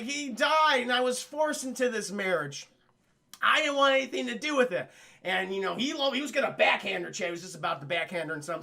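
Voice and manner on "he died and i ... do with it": raised, emphatic mock distress; acted aggrieved protest; upset protest. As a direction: plead your case indignantly. Acted princess protests forced marriage.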